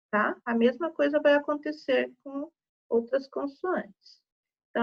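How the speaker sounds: normal talking voice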